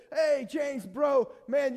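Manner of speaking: a loud, brotherly shout of the name; big matey hail to a brother; hearty call. Pretend. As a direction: call out to James like a buddy. Jesus imagined calling his brother.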